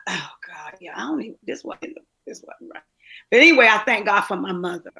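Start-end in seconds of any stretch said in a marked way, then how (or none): 0.1-2.8 barely audible, breaking into a whisper; words failing through real tears; choked up. Real, not acted. Overcome while talking about her mother, choked whisper. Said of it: "I just got caught in a moment".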